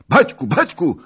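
high, loud desperate cry; shouting at the top of voices. Acted. Cossacks screaming for their chief, loud. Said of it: "krzyczeli w niebogłosy".

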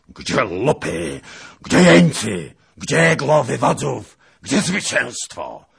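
loud, high, drawn-out furious shouting; hoarse-voiced demand. A narrator's reading. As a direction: shout each question as a raging hoarse demand. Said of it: "pytał ochrypłym głosem".

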